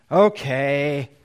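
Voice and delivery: imitating a child's wrong tone of voice; sulky okay. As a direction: answer like a child who resents the chore. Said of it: "But totally the wrong tone of voice".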